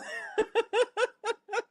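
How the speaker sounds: high fading giggle. Real, not acted. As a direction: let a laugh trail off high. Laughs after punchline.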